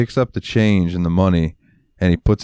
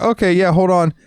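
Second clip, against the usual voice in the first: mock eager clerk; 12 semitones higher than usual. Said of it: "expecting me to be like".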